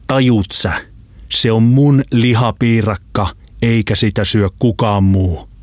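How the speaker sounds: slow, forceful menace; angry threat. Acted. Lambo warns his choking friend off his pie, threatening slowly. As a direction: drive each word home slowly.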